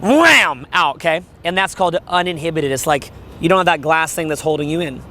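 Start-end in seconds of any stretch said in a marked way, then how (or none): 0.0-0.6 swooping, drawn-out "wham"; explosive wham. Shown as a demonstration. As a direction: burst out with a stretched wham. Demonstrates voice bursting out freely.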